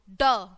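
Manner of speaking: ड said as a short, firm retroflex d; letter sound d. Shown as a demonstration. Teacher modelling D's sound for young learners. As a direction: tap out ड clearly and loud. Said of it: "ड बोलेंगे इसको हम".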